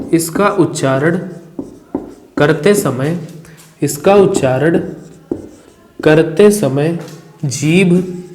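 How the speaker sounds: slow, word by word dictation with repeats. Shown as a demonstration. Teacher dictates the definition while students write. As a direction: give each phrase twice for writing.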